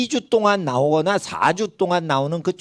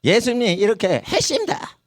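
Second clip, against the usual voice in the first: higher-pitched mock Gyeongsang preacher; Gyeongsang accent; 4 semitones higher than usual. Gyeongsang pastor preaching to a Jeolla listener, in mock regional accent. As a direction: put on a Gyeongsang dialect lilt. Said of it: "경상도 말이 나오니까".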